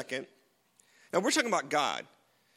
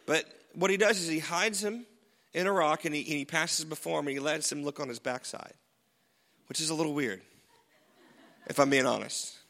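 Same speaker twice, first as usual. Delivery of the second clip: ordinary delivery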